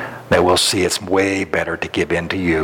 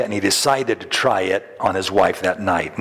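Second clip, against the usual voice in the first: ordinary delivery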